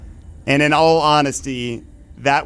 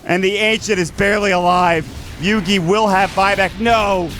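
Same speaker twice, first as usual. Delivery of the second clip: play-by-play; 4 semitones higher than usual. Acted. Tense late-game casting.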